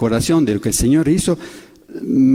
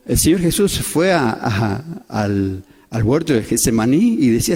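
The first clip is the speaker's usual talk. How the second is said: ordinary talk, nothing marked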